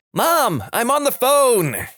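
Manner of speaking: put-on high, drawn-out teen yell; high-pitched yell to another floor; yelling upstairs. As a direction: call up the stairs to mum, exasperated. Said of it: "Me yelling upstairs".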